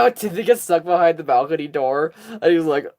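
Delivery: normal talking voice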